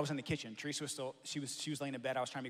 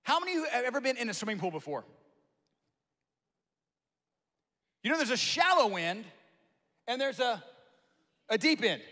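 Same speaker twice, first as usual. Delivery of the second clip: much higher and louder, ringing out; full-force preaching; 9 semitones higher, 10 dB louder than usual. Acted. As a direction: lift the room with it.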